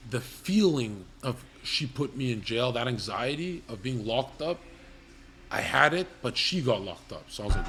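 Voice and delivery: ordinary talk, nothing marked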